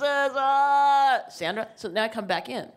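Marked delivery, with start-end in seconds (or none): 0.0-1.2 high, loud despairing cry; mock despairing cry; anguished wail. Despair at the scale.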